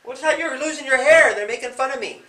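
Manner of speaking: loud, high mocking taunt; a kid bully mocking the officer's hair loss; teasing bully. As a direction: jeer at the officer's bald head like a kid. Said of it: "Or if they said".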